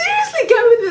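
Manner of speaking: loud, high urging; insistent plea. Real, not acted. Pleading with doubting co-host about a vision, loud insistence. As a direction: push to be believed. Begs to be believed.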